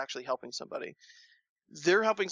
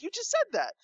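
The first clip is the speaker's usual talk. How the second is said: indignant retort. Retold or imitated. Retort to teller.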